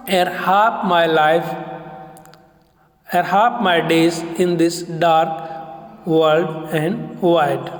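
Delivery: slow, unstressed-stressed beat on "Ere half my days in this dark world and wide"; teacher demonstrates the metre of the second line slowly; iambic reading. Shown as a demonstration. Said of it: "The first two lines of the poem illustrated".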